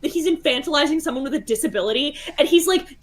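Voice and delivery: usual speaking voice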